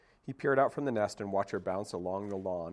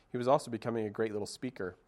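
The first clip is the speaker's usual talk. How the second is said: plain reading voice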